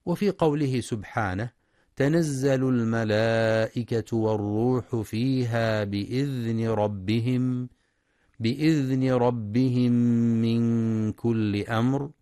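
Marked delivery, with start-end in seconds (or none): 1.9-12.1 slow, melodic tajweed recitation; demonstrating proper tajweed; Quran recitation. Shown as a demonstration. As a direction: recite with measured chanting. Recites verse as example.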